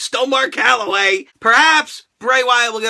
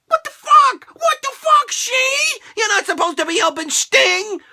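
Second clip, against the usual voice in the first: much higher shocked outburst; outraged disbelief; 11 semitones higher than usual. Retold or imitated. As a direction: shriek in fan betrayal. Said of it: "And I'm like".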